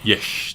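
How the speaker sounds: Dutch-style "Yes" ending in sh. Shown as a demonstration. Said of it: "I do the yes with the sh".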